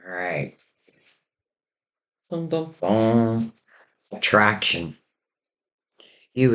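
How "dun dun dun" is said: slow "dun, dun, dun" reveal sting; sung suspense sting. Pretend. Tarot reader teasing the next card, mock suspense sting. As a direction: intone a drawn-out mock drumbeat for suspense.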